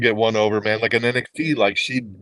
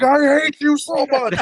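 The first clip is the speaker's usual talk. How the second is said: put-on much higher, slower kid's wail; daughter's outrage; slower than usual. Imitating his daughter turning on Bayley, high childish outrage. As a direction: drag it out like a furious little girl.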